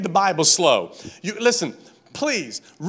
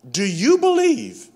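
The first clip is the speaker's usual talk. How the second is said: slow, raised, stressing "you"; slow emphasis; 6 semitones higher than usual. Shown as a demonstration. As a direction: draw out "Do you believe". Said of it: "here's a key phrase".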